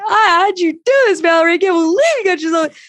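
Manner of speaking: high, loud hysterical mock panic; mock freak-out. Consultant mocking a hysterical reaction to a client, high loud panic. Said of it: "me going".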